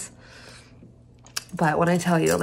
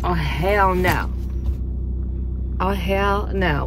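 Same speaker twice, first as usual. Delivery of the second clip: drawn-out disbelief; much slower than usual. Real, not acted. Dismay at no music cord.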